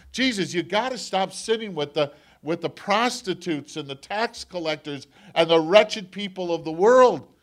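loud, high indignant complaint; indignant Pharisees. Acted. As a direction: rail against Jesus for his company. Pharisees complaining, voiced loudly.

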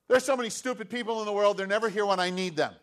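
loud, fast, high grumble; hammed-up self-pitying rant; whiny complaining. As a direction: rattle off a bitter gripe. Sick man voiced complaining about people.

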